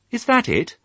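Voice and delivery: calling after them. Acted. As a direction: call after the departing flock. Fly the dog calls after sheep.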